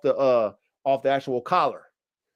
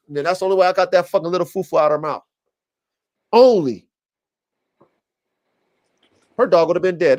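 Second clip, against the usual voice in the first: ordinary talk, nothing marked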